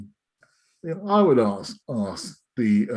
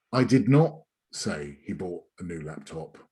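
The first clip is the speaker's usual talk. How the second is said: not pushed as the key word; stress on not; 3 semitones lower than usual. Shown as a demonstration. Trainer demonstrates emphasis, stress on not. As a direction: lean on not. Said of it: "just by changing the emphasis".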